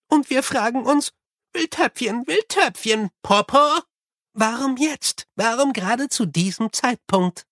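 a toddler-voiced old abbot, curious; babbling baby abbot. A narrator's reading. (hohe Kleinkindstimme des Abts)

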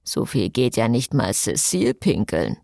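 lightly voiced man, low teasing aside; a hint of a low male voice joking; mocking murmur. A narrator's reading. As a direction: tease her under your breath. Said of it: "murmelte Jason".

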